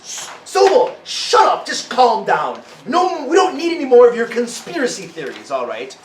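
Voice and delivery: a doctor silencing her panicking colleague; hushing doctor. A narrator's reading. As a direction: shut him up with exasperation. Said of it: "looks back to the guy who's freaking out and says".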